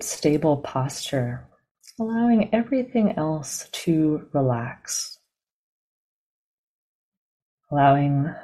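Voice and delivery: calm, measured meditation guidance with long pauses; guided meditation. Real, not acted. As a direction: guide them gently into the body, leaving silence between phrases.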